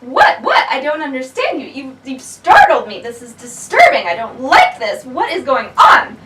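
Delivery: loud, flustered "what, what?"; startled bird. Acted. Bird startled by the talking unicorn, loud flustered confusion. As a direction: sputter it out, rattled and alarmed.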